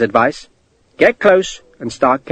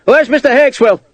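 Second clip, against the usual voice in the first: much higher, loud shout; 11 semitones higher, 4 dB louder than usual. A narrator's reading. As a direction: shout for him across the wall. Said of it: "he shouted".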